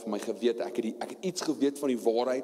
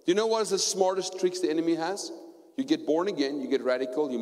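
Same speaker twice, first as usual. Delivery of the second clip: higher and louder, preaching at full force; fiery preaching; 8 semitones higher, 3 dB louder than usual. Acted. Preacher crying out to the church about the blood. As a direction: cry it out from the pulpit.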